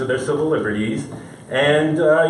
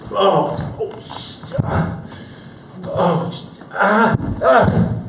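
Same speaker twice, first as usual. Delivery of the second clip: mock pained gasps; 4 semitones higher, much slower than usual. Acting dying gasps.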